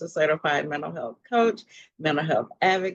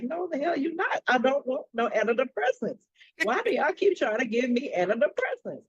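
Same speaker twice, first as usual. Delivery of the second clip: pitched up, firm and fed-up; sassy refusal; 7 semitones higher than usual. Retold or imitated. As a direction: shut the idea down hard. Retold outburst at psychiatrist.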